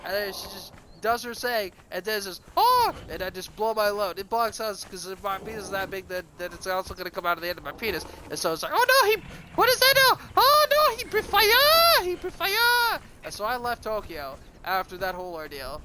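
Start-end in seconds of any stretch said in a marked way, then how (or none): 2.6-2.9 short, loud falsetto "oh"; mock high cry. Prostitute's reaction during sex, acted. 8.7-13.0 hammed-up, very high falsetto screaming; mock mass panic. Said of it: "all of Japan is freaking out".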